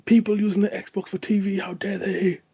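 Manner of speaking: high-pitched mock indignation; Willy Wonka outrage. Podcaster mocking the co-host's snobbery, high mock outrage. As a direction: act scandalised, pitched well up. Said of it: "Like Willy Wonka".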